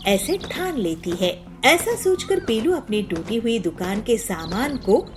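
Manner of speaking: usual speaking voice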